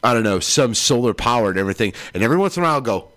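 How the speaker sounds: normal talking voice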